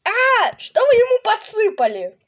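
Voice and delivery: high shocked shout; shocked outcry. Acted. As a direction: cry out in alarm. Shocked outburst.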